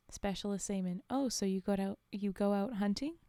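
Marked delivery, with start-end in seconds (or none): none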